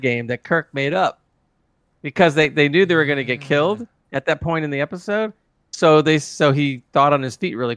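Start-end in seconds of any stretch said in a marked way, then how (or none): none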